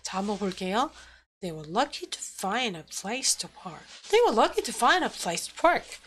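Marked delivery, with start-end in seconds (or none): 1.4-3.8 soft, low rhythmic read stressing "lucky", "find", "place", "park"; stress on content words. Shown as a demonstration. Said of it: "이런 것만 강세를". 4.1-6.0 higher, singsong beat on "lucky", "find", "place", "park"; stress on content words. Shown as a demonstration. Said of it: "이렇게 읽게 돼요".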